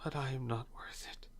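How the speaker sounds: frail whisper of self-reproach; barely voiced, too weak to speak up; weakly. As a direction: whisper it faintly, defeated. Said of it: "Azil in Finn's arms very weakly".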